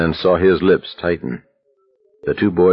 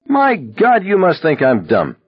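hollering; 11 semitones higher than usual. A narrator's reading. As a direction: bellow in disbelief. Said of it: "Abraham hollered".

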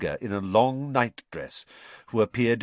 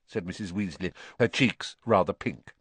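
usual speaking voice

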